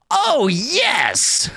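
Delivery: hammed-up anime squeal of oh, yes!; overacted anime dub. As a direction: ham it up like an anime girl. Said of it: "Why'd that sound like an anime".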